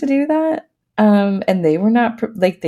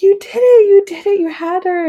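much higher and louder, overjoyed cheer; excited cheering husband; 12 semitones higher, 5 dB louder than usual. Retold or imitated. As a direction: cheer her on like a thrilled husband.

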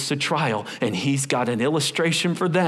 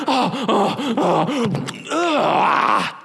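loud mock groan of disgust; mock yuck, louder and higher; 9 semitones higher, 5 dB louder, much slower than usual. Israelites tasting bitter water, disgusted groan. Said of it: "They get to the water".

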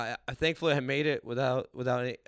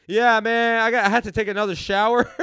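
raised, higher put-on phone-excuse voice; loud excuse call; 8 semitones higher, 11 dB louder than usual. Comic telling Supernova he'll miss his set, loud excuse. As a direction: announce a lame excuse too loudly.

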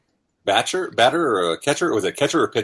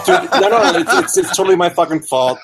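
laughing admission; 4 semitones higher, 4 dB louder than usual. Real, not acted. Admitting the delay, laughing.

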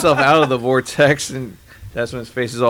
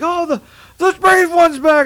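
mock cheering crowd; 4 dB louder than usual. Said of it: "and everyone's like".

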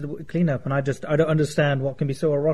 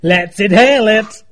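loud, high eager cheer; excited shout; 6 semitones higher, 12 dB louder than usual. Real, not acted. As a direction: shout it out, thrilled. Eager to try the whippet.

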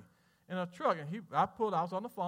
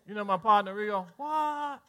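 usual speaking voice

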